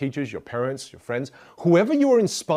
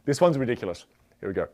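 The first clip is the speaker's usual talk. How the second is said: ordinary talk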